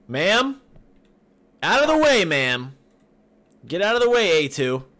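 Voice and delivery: high, loud order to move; exasperated yelling. Pretend. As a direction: yell at someone blocking your way. Yelling at character blocking window.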